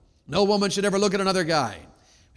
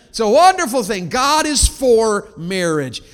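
normal talking voice

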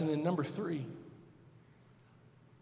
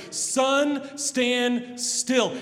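Joshua's bold command; 9 semitones higher, 8 dB louder, much slower than usual. Acted. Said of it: "impossible prayer that Joshua prays".

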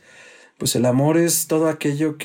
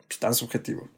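ordinary talk

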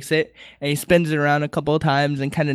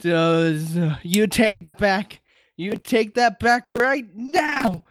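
hammed-up high-pitched outrage; slow, mock-offended old man; gasp, then demand it high and drawn out; Master Roshi; 5 semitones higher, much slower than usual.

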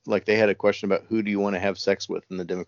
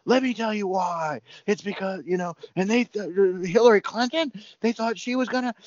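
mock frothing rant; 12 semitones higher than usual. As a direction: ham up a comedian frothing at the mouth. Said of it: "works himself up into a froth".

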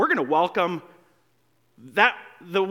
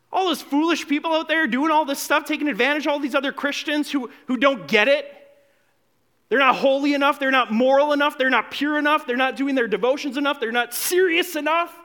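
judgmental sneer; 7 semitones higher, 3 dB louder than usual. Retold or imitated. Mocking the judgmental older-brother attitude.

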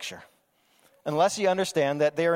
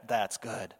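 quietly pleased; 8 semitones lower, 8 dB quieter than usual. Retold or imitated. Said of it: "he goes like".

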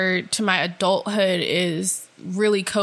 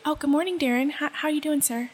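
higher, softer proper office greeting; a touch higher professional politeness; polite work voice; 6 semitones higher, 4 dB quieter, faster than usual. Retold or imitated. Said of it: "I did that with my last contract too".

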